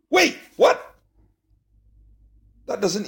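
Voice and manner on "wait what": sudden high startled "wait, what?". Pretend. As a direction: caught off guard, voice jumps up. Reader startled by the text.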